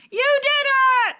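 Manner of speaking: very high, loud, drawn-out cheer; excited cheer. Shown as a demonstration. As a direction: celebrate his win like a big victory. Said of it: "try saying".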